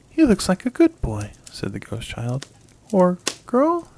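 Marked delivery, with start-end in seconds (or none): 0.1-1.3 put-on high, bright little boy voice; a small boy, cheerful and loud; ghost child. A narrator's reading. 2.9-3.9 put-on high, puzzled little boy; small boy wondering aloud; ghost child. A narrator's reading.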